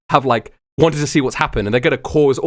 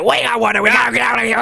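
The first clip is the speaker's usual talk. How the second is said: higher, pressing urgency; urgent wake-up call; 9 semitones higher, faster than usual. Acted. Waking Wanda to get out of here, urgently. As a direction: rouse them in a hurry.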